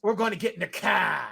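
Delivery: put-on Boston with "car" as "cah"; hammed-up Boston accent; bad Boston accent. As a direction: stretch car out, Boston style. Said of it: "saying things in a bad Boston accent".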